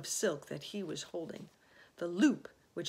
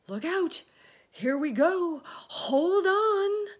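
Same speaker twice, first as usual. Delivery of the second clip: high, loud cries rising in pitch; excited high-pitched calls; warning shouts; 11 semitones higher, 13 dB louder, slower than usual. A narrator's reading. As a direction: shout warnings to everyone aboard. Warning cries as peach lifts.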